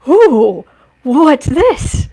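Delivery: loud curious dog voice; dog voiced as eager and nosy; voicing the dog. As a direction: play the curious puppy finding treats. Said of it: "he was like".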